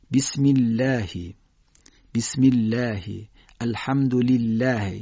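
slow "بسم الله، الحمد لله" with thin "l" and fronted "a"; drawn-out light-lam demonstration. Shown as a demonstration.

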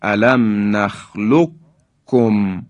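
نخلقکم as nakhlukkum with no q; slow recitation, long doubled k in نخلقکم; doubled, firm kaf. Shown as a demonstration. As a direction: merge ق into ک in نخلقکم.

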